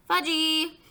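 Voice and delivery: put-on high, loud call after a toddler; hollering mother. A narrator's reading. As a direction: holler it drawn-out.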